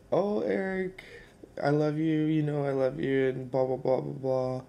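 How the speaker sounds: high-pitched, heartfelt outpouring of love; imitating an overcome grandmother; retold emotional grandma.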